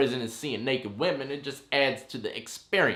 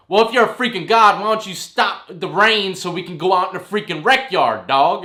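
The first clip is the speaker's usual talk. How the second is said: higher and louder, teasing jab at a self-made god; mocking taunt; 6 semitones higher, 10 dB louder than usual. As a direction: needle him loudly for the laughs. Mocking cellmate who claims godhood.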